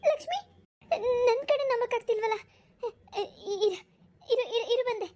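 high, tearful cries of her daughter's name; crying with joy. Acted. Mother sees married daughter return home, crying with joy. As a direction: sob out the name, overwhelmed with relief.

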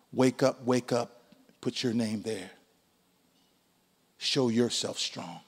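God's gentle call. Acted. God urging the congregation to act, gently. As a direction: coax them awake quietly.

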